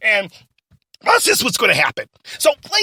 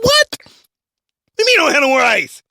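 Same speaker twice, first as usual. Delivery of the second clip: raised, high-pitched disbelief; outraged boss; 5 semitones higher, 4 dB louder than usual. Acted. Boss can't believe there is no ice, outraged. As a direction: throw it back at the assistant, indignant.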